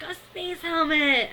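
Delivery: put-on high, loud, drawn-out little-kid cry; hammed-up delighted toddler exclamation; Phil, badly imitated. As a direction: stretch it out in a failed excited child voice. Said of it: "I can't do Phil".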